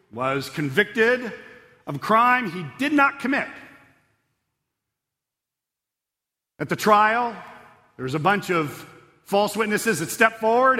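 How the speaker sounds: fervent sermon voice; preaching at full force. Acted. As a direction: drive the story home from the pulpit. Preacher builds the story.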